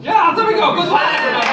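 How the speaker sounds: loud, high crowd call; rally the whole room; loud and high celebratory shout. Real, not acted. Shouting to audience after trick.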